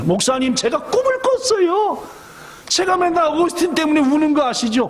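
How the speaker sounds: high, eager and breathless; mother runs to her pastor, thrilled by a dream; excited mother. Acted. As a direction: rush to tell the good news.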